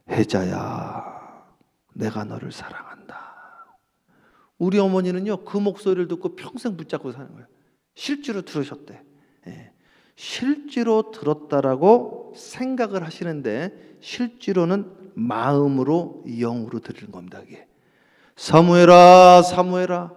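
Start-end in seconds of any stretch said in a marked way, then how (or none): 0.1-3.2 very slow low call, then a whisper; whispered voice of God. Acted. God speaking softly to the praying mother, whispered. 18.4-20.1 divine call. Acted. God calling Samuel.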